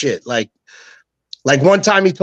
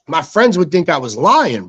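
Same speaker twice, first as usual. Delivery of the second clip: ordinary talk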